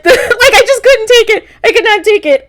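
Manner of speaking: high-pitched, laughing overwhelm; cracking up. Real, not acted. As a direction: squeal it through laughter, unable to cope. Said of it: "I just died".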